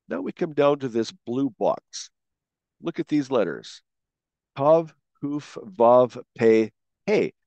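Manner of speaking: ordinary talk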